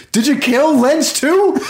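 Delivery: high, strained mock shout; mock accusation yelled across a theater; imitated loud yell. Friend accusing theater employee of murder, high mock yelling. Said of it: "As loud as you can".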